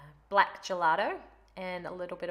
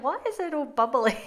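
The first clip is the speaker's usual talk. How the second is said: higher, louder, baffled question; replaying her own confusion, pitched up; puzzled surprise; 9 semitones higher, 8 dB louder than usual. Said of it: "I was like".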